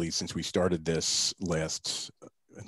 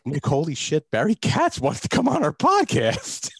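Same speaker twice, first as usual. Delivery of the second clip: much higher and louder, stretched slow with glee; excited disbelief; 11 semitones higher, 7 dB louder, slower than usual. Retold or imitated. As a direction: squeal it like you can't believe your luck. Retelling his thrill at Barry's yes.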